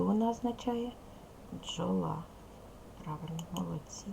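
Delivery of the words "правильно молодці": whisper. Real, not acted. Teacher whispering to class.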